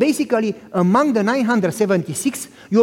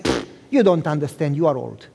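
dismissive son; 6 semitones lower than usual. Retold or imitated.

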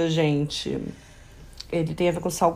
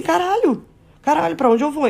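panicked; 8 semitones higher, 3 dB louder than usual. Retold or imitated. Retold panic at closing bar.

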